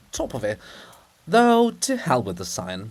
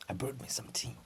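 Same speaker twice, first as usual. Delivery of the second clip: whisper; 13 dB quieter, faster than usual. Real, not acted. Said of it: "aside whisper".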